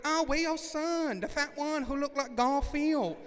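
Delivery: high, loud imitation of a salon worker; high-pitched nosy worker voice; nail salon worker. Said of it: "they're like".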